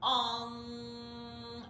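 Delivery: chanted nasal Om buzzing in the upper palate. Shown as a demonstration. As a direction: hum the Om up into the nose. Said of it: "press up ... vibrate into the upper palate".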